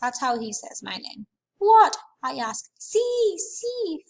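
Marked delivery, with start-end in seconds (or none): 1.6-2.0 sudden high, loud what; a loud startled question. Acted. Narrator startled by Fudge. 2.8-4.0 put-on high little-kid voice; much higher childish "see, see"; toddler voice. Fudge, a toddler, flaps arms.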